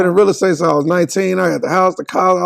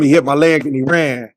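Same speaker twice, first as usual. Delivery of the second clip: ordinary talk, nothing marked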